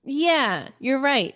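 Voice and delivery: ordinary talk, nothing marked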